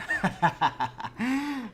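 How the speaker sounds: high gleeful burst of laughter. Real, not acted. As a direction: cackle with delight at the sneak attack. Laughs after sneaking up.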